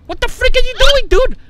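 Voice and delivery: high, loud outburst of disbelief; exasperated yell. Real, not acted. Player to Santa who threw the cookie, exasperated yell.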